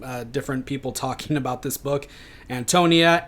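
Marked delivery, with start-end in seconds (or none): 2.5-3.3 Antonia as an-TOH-nee-uh; variant pronunciation. Shown as a demonstration. Lecturer listing heard variants, Antonia pronounced an-TOH-nee-uh. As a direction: say Antonia with a long O, stressed TOH. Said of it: "I've heard her name pronounced differently".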